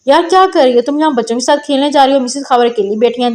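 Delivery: ordinary delivery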